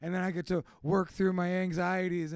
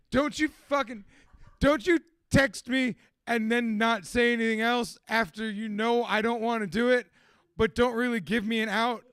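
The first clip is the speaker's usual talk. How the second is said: mock scolding; 4 semitones higher, 7 dB louder than usual. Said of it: "I'm like".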